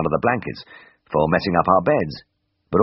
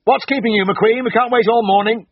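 loud, much higher, impatient bark; barked call; 5 dB louder than usual. A narrator's reading. Surgeon calling the anaesthetist from theatre, loud impatient bark. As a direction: bark it from the next room, impatient. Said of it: "a voice barked from the theatre".

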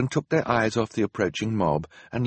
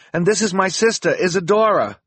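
high, louder character voice; hurried introduction; 10 semitones higher, 6 dB louder than usual. A narrator's reading. Duncan introducing his sister to Hector, high and hurried. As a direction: present your sister, pitched high. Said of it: "he said quickly".

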